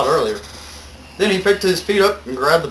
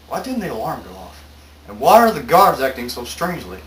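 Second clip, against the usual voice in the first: ordinary talk, nothing marked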